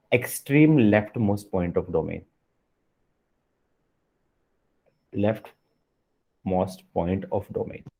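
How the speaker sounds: very slow, word by word, for note-taking; dictating to the class at a crawl; slow dictation. Shown as a demonstration. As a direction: spell it out slowly so students can write it down. Teacher dictating a definition.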